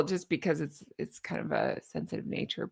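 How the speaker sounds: hushed, unvoiced aside; whispered. Real, not acted. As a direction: drop to a whisper on this line. Speaking of a sensitive book.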